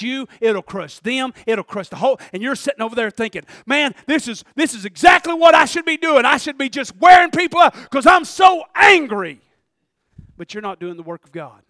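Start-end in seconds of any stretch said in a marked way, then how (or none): 3.7-9.4 hammed-up angry rant, building loud; acted anger. Preacher voicing an angry person justifying themselves, acted anger. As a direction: work yourself up to a shouted mock outburst.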